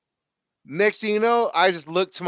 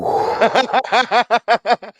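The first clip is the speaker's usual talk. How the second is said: loud laugh; 7 semitones higher, 8 dB louder than usual. Real, not acted. Speaker laughs about stealing tape.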